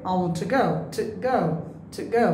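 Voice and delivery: "to" reduced to a short tuh before "go"; weak form. Shown as a demonstration. Teacher showing to before a consonant, weak form. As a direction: shrink "to" to a quick t' ahead of "go". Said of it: "যদি এরপর consonant থাকে".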